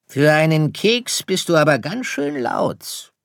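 storybook fox, teasing; sly fox. Acted. Fox teases the star. (neckender Fuchs, hohe Stimme)

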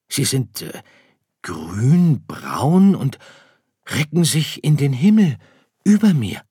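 put-on high, loud child-figure voice; little star marvelling at what towers above. Story figure Sirius marvels. (staunender kleiner Stern)

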